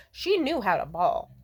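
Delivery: normal talking voice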